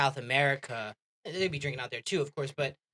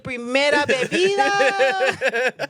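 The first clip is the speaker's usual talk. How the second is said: slow, high, loud mock Spanish; Spanish accent; 8 semitones higher, 6 dB louder, slower than usual. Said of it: "said a Spanish accent".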